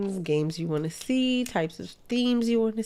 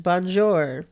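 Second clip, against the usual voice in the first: French accent. Shown as a demonstration. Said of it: "try to speak French".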